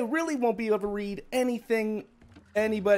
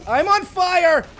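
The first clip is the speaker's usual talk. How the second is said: much higher, louder gleeful cry; excited shout; 12 semitones higher, 10 dB louder, slower than usual. Real, not acted. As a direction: shout it out like everything's exploding. Overwhelmed streamer during a raid.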